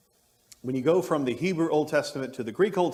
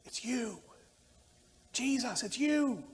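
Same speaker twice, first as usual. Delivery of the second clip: high, hushed and amazed; a soft high gasp of recognition; sudden recognition; 11 semitones higher, 4 dB quieter, slower than usual. Retold or imitated. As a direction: breathe it out as she realises. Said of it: "that she suddenly goes".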